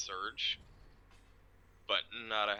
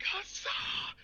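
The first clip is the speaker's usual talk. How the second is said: high, breathy mock shout; battle cry. Boisterous dragon knight opening every combat, mock shouting. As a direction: let loose a hoarse-whispered roar of triumph. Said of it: "this boy's gonna start fucking shouting".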